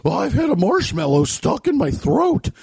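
high, slow marshmallow-throated warble; weird customer voice. Retold or imitated. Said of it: "get a voice like that".

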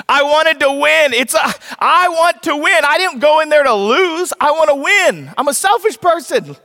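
high-pitched, hammed-up frustration; sore-loser outburst. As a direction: protest like a mock sore loser. Acts out his sore-loser frustration.

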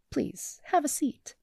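sweetly polite through the annoyance; forced politeness. Acted. Therapist inviting Starscream to sit, with a forced smile. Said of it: "forcing a polite smile".

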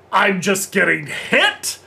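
high, loud, slow bewildered Joker; confused Joker. Retold or imitated. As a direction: whine it as the battered Joker. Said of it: "And the Joker's like".

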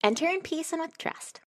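high, fast, soft showy welcome; theatrical welcome. Acted. Chafra welcomes Thrawn after a theatrical bow, high and showy. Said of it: "a very theatrical bow".

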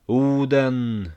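a loud, far-off war cry of "Oden!"; battle cry. A narrator's reading. Warriors charging the cross-bearers, a loud war cry. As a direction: shout the god's name in triumph. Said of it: "ropar några av dem".

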